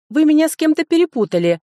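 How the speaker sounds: loud, high indignant cry; indignant shout. A narrator's reading. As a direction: shout him off. Escaping, shouting at stranger. (громко возмущённо кричит)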